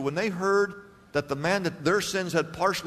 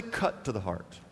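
much lower and softer; soft repeat; 10 semitones lower, 10 dB quieter than usual. Shown as a demonstration. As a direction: hand them the phrase quietly.

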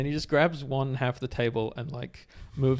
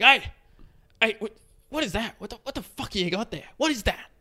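high, pushy squawk in mock outrage; crazed squawking; 10 semitones higher than usual. Crazed man accosting girl at another table, squawking. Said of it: "kinda crazed voice".

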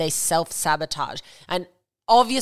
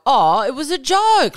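higher, louder, drawn-out brush-off; mock deflection; 7 semitones higher, 6 dB louder, slower than usual. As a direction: act out waving it away as a joke. Acted deflection when confronted.

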